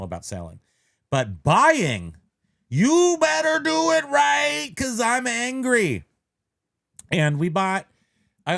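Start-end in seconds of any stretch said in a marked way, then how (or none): 2.7-6.0 high, slow, loud warning; mock-furious singsong; mock anger. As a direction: drag it out as a hammed-up threat. Mock threat to sellers.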